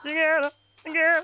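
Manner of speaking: high, fast sung [name] [name]; a light [name] imitation; [name] impression. Shown as a demonstration. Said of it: "I can do [name]".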